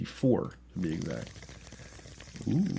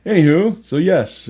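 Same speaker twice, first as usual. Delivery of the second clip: loud anywho; 6 semitones higher, 10 dB louder, slower than usual. Real, not acted. Loud reset after joke.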